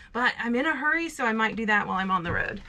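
normal talking voice

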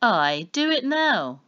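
slow, loud menacing command; menacing order. A narrator's reading. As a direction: drag it out like a threat. Boar man orders them to kill.

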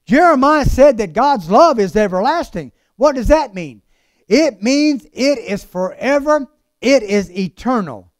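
emphatic preacher with big rising swells; preaching. Acted. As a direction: preach it in strong, spaced phrases. Preaches at full force.

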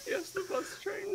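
high, wailing reply; dramatic cry. Pretend. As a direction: wail it back, mock tragic. Mock villain reply.

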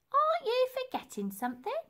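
very high, knowing lady's voice; hint at what she forgot; teasing reminder. Acted. Dotty calling down to Elsie, high and knowing.

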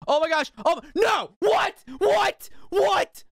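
very high, frantic yelling; high shrieks of gamer panic; panicked shrieking. Real, not acted. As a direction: escalate into shouted disbelief. Panic as the jump fails.

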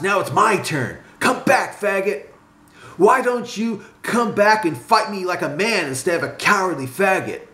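high-pitched hollering challenge; calling out tauntingly. A narrator's reading. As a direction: holler after him to come back and fight. Calls after retreating friend.

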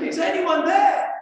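shouted up a cliff, high and loud; clinging to a cliff, shouting up for help; desperate shout. Acted.

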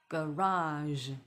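"garage" ending in a soft zh sound; teacher's careful "garage", zh at the end. Shown as a demonstration. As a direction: stretch "garage" into a final buzzing zh. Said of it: "后面那个日的声音".

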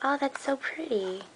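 ordinary talk

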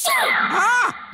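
high startled shout; loud cry. Real, not acted. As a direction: yelp at the noise. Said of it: "that's loud".

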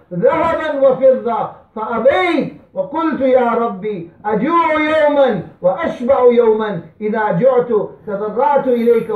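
loud, ringing Arabic recitation; impassioned preaching. Acted. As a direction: recite the hadith with preacher's force.

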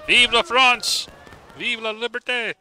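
slow, loud patriotic battle cry; mock French rallying cry. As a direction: bellow it like a French officer. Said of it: "Or something like that".

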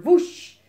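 loud, high rising whoosh; wing whoosh. A narrator's reading. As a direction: swoop up hard on one wingbeat. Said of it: "steeds harder".

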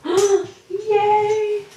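relieved gasp, high yay; gasp, then cheer high. Real, not acted. Mirror survived the drop.

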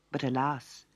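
ordinary delivery

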